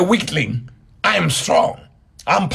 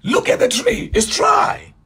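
high, excited cry of surprise; calling out to the Lord in amazement; astonished disciples. Retold or imitated. Disciples calling to their Lord, high and astonished. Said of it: "And they said".

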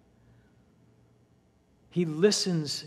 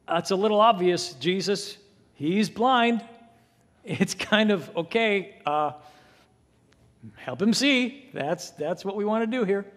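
higher, louder, mock-exasperated; the listener scoffing at Jesus, mock disbelief; incredulous aside; 4 semitones higher, 5 dB louder than usual. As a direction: play the skeptic who finds it too obvious. Listener's imagined objection.

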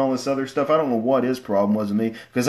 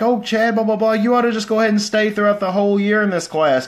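higher, louder doting teacher voice; mock-sweet teacher, higher and louder; fond teacher; 8 semitones higher, 4 dB louder than usual. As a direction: gush over him like a fond teacher. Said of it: "she was like".

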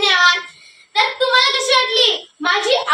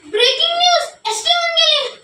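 shocked; 6 semitones higher than usual. Acted. News of father's bus swept away.

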